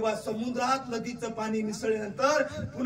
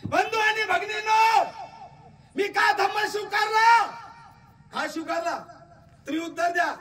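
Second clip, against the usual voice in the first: voicing Babasaheb; 13 semitones higher, 4 dB louder than usual. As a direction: proclaim it like the leader on stage. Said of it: "Babasaheb म्हणतात".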